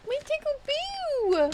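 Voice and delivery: loud, high, drawn-out cry; playful high-pitched whoop; silly high squeal. Pretend. Greets a new follower playfully.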